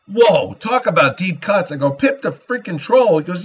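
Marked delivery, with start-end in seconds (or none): 1.9-3.2 amazed disbelief. Retold or imitated. Amazed at a deep-cut answer.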